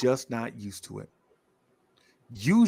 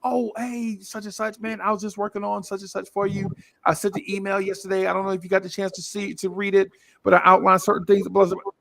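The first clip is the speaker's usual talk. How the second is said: higher, polished business voice for an investor; smooth-talk an investor on the phone, pitched up; 6 semitones higher than usual. Retold or imitated. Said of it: "This is me talking to, like, an investor".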